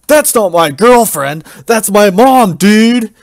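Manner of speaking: loud, high indignant yell; yelling back. Acted. Sir Boomer yells at Randy about his mom, outraged. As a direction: shout him down, offended. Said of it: "Sir Boomer yells back".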